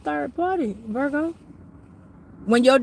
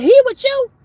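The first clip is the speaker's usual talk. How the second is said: high, loud mock demand, voice pitched way up; mocking girlfriend's text; 14 semitones higher, 10 dB louder than usual. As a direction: ham up a pushy girlfriend's question, much higher and louder. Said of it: "She got now text my phone and said".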